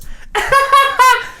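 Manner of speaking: very high, rapid mock laugh; fake second laugh. Showing friends Emily's new laugh, high put-on cackle. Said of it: "it sounded like this".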